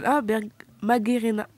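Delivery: usual speaking voice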